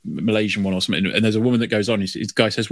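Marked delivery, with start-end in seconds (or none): none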